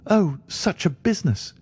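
high, distraught cry; a doctor crying out in horror; cried out in distress. A narrator's reading. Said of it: "he cried with his hands to his temples".

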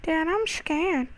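put-on high, slow frightened child voice; scared kid voice. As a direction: play a little kid calling for dad. Pretend scared kid calling father.

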